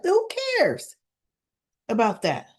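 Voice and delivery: high, scoffing who cares; dismissive who cares. Real, not acted. Narrator scoffing at a dull scene, dismissive. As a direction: brush off the boring backstory. Exasperated recap aside.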